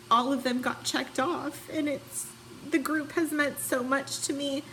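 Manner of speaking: teary, voice a little up and wavering; holding back tears as she speaks; emotional. Real, not acted. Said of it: "I'm sorry I'm so emotional".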